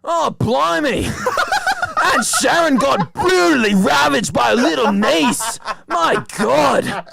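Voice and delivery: very high, wailing hammed-up Cockney; Cockney accent. Nephew giving aunt's eulogy, hammed-up Cockney wail. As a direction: shriek it out in a mock Cockney lament. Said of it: "I said it in a British accent, like a Cockney one".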